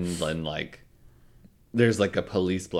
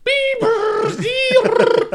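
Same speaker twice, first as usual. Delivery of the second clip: dial-up screech; 7 dB louder than usual. Retold or imitated. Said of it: "reminiscent of my past".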